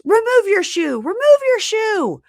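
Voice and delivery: very high, loud command; hammed-up, high-pitched shouted order; mock priestly yell. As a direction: holler it like an overwrought priest. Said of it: "he yells out as she walks in".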